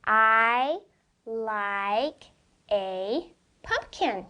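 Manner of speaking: very slow reading, each word set apart. Shown as a demonstration. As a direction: stretch out every word for the child. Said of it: "Let's read it kinda slow".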